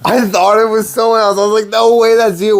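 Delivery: high-pitched incredulous reaction; astonished, voice shooting way up; amazed disbelief. Real, not acted. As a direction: squeal it, unable to believe it.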